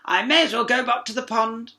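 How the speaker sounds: hurt frog; sobbing frog. A narrator's reading. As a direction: complain through tears. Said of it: "sobbed the frog".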